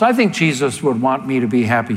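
ordinary talk, nothing marked

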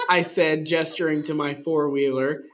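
usual speaking voice

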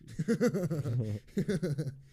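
loud burst of laughter; real laugh in two bursts. Real, not acted. Speaker after a grim joke about phoning family, laughing out loud.

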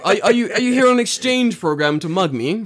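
high, loud mock delight; comic over-polite astonishment, pitched high; incredulous mugging victim. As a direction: question the mugger in a hammed-up high voice. Mock victim answering the mugger.

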